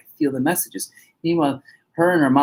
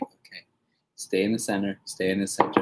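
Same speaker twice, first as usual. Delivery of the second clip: low, soft self-calming murmur; husband calming himself amid the frenzy; calm centering; 6 semitones lower, 7 dB quieter than usual. Retold or imitated. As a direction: quietly talk yourself back to center. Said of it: "I'm just like".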